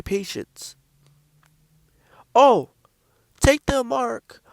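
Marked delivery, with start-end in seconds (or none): 2.3-4.4 a pitched-up, slow, coaxing doctor voice; sweet-talking doctor. Retold or imitated. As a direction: coax the patient along sweetly, high and unhurried. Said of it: "you know how sweet the motherfuckers talk".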